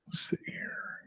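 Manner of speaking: whispered under the breath; whispering. Real, not acted. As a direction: mutter it to yourself in a whisper. Player muttering to himself.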